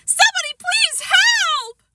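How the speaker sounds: panicked cry. Acted. Sheila falling off swing.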